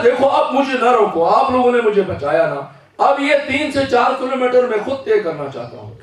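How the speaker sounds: put-on the young volunteer begging to go on; impassioned young man. Preacher voicing pleading youth.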